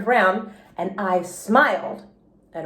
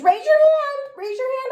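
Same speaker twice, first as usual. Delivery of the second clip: hollered; 14 semitones higher than usual. A narrator's reading. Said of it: "I hollered".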